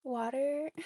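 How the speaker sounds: "Water" with an American r, rising; American accent. Shown as a demonstration. Repeating the comparison for viewers, American accent. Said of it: "And then American is water".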